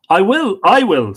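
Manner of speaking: high, loud, eager promise; eager warrior volunteers loudly; eager loud vow. Acted. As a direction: leap at the task.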